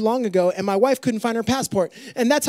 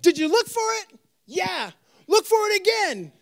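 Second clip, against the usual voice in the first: parent voice; 8 semitones higher than usual. Retold or imitated. Parents answering the kid.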